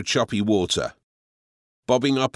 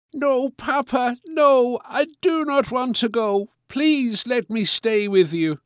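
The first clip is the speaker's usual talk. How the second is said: put-on tearful little girl; 13 semitones higher than usual. A narrator's reading. Little girl pleading with her father, tearful and high. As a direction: beg her papa not to send her away. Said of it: "With tears vibrating in her eyes".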